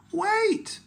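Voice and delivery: very high call; pirate girl crying out to stop the plank; cried out. Acted. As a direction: cry out to stop them. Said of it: "she cried".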